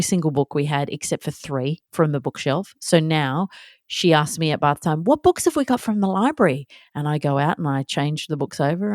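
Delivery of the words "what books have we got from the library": put-on child asking. Child's bath-time question voiced.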